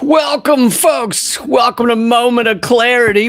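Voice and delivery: loud, high host greeting; booming welcome to the audience; big show intro. Acted. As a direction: belt it out like a showman opening the show. Show host opening shout.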